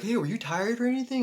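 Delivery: high, questioning voice; worried friends. Retold or imitated. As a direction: mimic the girls asking. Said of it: "They're like".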